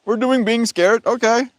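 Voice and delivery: high, loud, gleeful cosmic voice; the universe taunting a fearful person, gleeful and high; voicing the universe. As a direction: play the universe eagerly raising the stakes. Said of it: "The universe is like".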